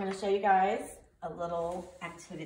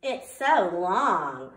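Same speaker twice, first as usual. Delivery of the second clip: slow, loud drawn-out tease; mocking classmate; 6 dB louder, much slower than usual. Classmate Joe teasing Chrysanthemum's name, slow and loud. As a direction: stretch it out to mock her. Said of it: "said Joe".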